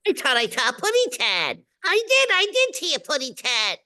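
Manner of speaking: high-pitched cartoon baby bird; cute, high baby-talk bird voice; Tweety. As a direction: pipe it up high like a tiny cartoon canary. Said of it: "you do Tweety, I guess".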